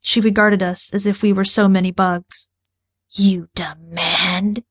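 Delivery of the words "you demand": slow, harsh whisper like grinding glass; menacing creature rasp, drawn out; grating alien whisper. Acted. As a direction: scrape the words out slowly, cold and contemptuous. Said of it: "Her voice grated like ground glass crunching under boots".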